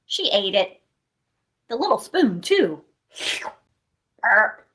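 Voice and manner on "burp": playful burp sound; a cartoon burp for kids; mock burp. After eating the porridge.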